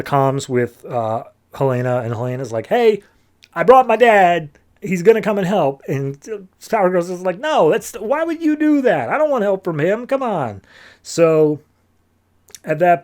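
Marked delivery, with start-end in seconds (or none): 2.7-5.8 high, loud, upbeat call; excited announcement. Retold or imitated. Helena announcing over comms that she brought dad, excited announcement. 7.4-10.6 high-pitched whiny objection; exasperated protest. Retold or imitated. Power Girl refusing her friend's dad's help, exasperated protest.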